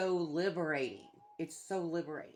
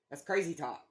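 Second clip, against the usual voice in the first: ordinary talk, nothing marked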